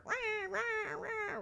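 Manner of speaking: high, harsh wailing rah; horrible voice. Retold or imitated. Neighbor mimics the homeless lady under the spotlight, harsh high wail. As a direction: mimic a shrieking homeless woman. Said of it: "with her horrible voice".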